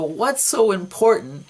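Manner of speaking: high, loud and slow, pressing the question; demanding. Retold or imitated. Dreamer demands answers from his partners, loud and high. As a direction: ask the partners sharply what's going on.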